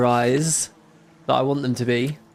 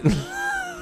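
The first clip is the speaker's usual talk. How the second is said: very high squeaky cartoon chuckle; Mickey Mouse laugh; 6 dB quieter than usual. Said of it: "you love my Mickey Mouse voice".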